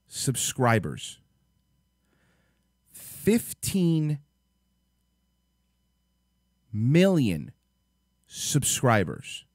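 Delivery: drawn out, word by word with long pauses; slow disbelief. Real, not acted. Stunned vlogger reading out a subscriber count, slow disbelief. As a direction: let each word land, stunned.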